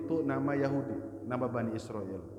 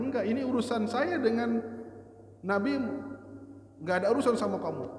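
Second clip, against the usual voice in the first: defiant refusal; 10 semitones higher than usual. Acted. Hibrun refuses angrily.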